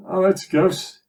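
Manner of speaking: called out high and loud across the street; high, loud hail across the road; shouted greeting. Retold or imitated. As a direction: shout it across to him cheerily. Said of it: "He shouted".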